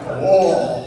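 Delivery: low startled whoa; stunned, under your breath; alarmed whoa. Retold or imitated. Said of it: "And I'm like".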